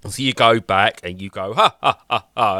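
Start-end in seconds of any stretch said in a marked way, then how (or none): 1.5-2.6 deliberate, spelt-out "ha ha" laugh; mock laughter; fake mocking laugh. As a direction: imitate laughing in someone's face. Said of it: "you laugh at him".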